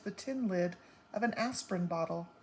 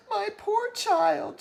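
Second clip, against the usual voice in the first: much higher, louder, slow lament; lamenting mother; 13 semitones higher, 8 dB louder, slower than usual. A narrator's reading. Mother lamenting to her daughter, high, loud and slow. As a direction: mourn over your child.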